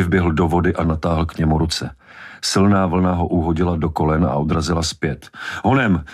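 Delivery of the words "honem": higher-pitched urgent call; urging. A narrator's reading. Brody urging the boy out of the surf, urgent. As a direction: hurry someone along, pitch jumping up.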